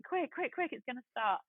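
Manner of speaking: high, hurried urging while running; urgent hurry. Retold or imitated. Running late up the steps.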